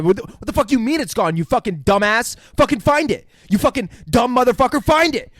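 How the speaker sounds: loud, high mock rage; acted furious tirade, rising in pitch; imitated freak-out. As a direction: berate them, building to a yell. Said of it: "freaking out on Miles".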